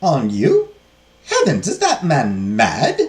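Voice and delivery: loud, slow disbelief; scandalized nun. Acted. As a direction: react aghast, stretching the words. Nun shocked by incest.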